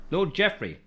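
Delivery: chewing while reading a name; mouth full. Real, not acted. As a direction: talk through a mouthful. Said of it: "talk with my mouth full".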